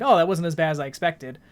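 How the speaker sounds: ordinary talk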